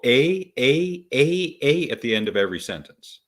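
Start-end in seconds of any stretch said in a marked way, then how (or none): 0.0-1.9 "a, a, a, a" as repeated higher, questioning ehs; exaggerated Canadian "eh". Shown as a demonstration. Denying Canadians end every sentence so, mock "eh". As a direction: punch each "a" as a mock Canadian "eh". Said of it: "We don't go".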